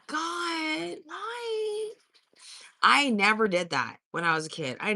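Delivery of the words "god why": mock anguish. Mock despair at slang.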